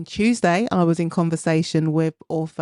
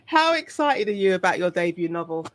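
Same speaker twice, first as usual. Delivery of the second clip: usual speaking voice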